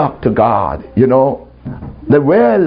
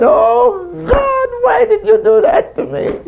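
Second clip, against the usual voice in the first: very high, hammed-up plaintive cry; mock wailing. Preacher retelling an accusation of God, high mock wail. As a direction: wail it at heaven in mock grievance.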